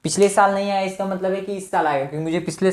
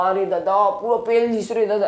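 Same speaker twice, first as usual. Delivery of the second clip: mock grumble of someone squeezed in a crowd; a hint of a crowded-fair villager moaning; jostled fairgoer; 3 semitones higher than usual. Teacher voices crowd at fair.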